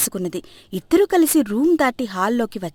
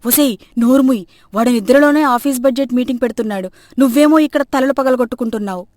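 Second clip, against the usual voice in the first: loud mother-in-law's reprimand; scolding; 2 semitones higher, 6 dB louder than usual. Acted. Mother-in-law hushing a clumsy daughter-in-law, loud scolding. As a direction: scold her with raised voice.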